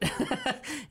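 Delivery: high laughing burst; a quick, high laugh of surprise; burst of laughter. Real, not acted. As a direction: laugh in disbelief. Laughing at mom's remark.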